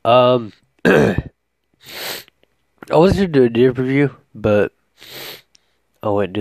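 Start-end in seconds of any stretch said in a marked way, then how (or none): none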